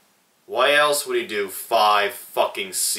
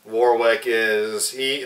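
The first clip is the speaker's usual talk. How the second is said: normal talking voice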